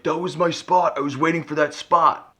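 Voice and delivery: high, whiny mock of an entitled driver; mocking the guy who lost his parking spot, high and whiny. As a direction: whine it like a spoiled stranger. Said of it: "He's like".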